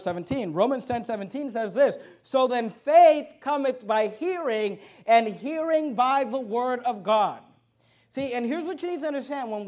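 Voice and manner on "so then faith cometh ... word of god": slow, raised-voice verse reading; emphatic scripture reading. Acted. Preacher reads Romans to the church, slow and declamatory. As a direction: stretch out each key word of the verse.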